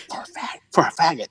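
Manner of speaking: very soft, whispery aside; furtive qualifier. Retold or imitated. Locals add a backhanded qualifier, hushed and furtive. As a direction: tack it on under your breath.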